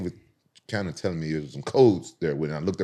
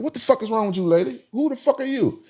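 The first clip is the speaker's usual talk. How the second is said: high, raised-voice mock outrage; retold indignant outburst; 4 dB louder than usual. Man confronts a lady who grabbed his shoulder, indignant and high-pitched. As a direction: act out snapping back at a stranger, pitch way up.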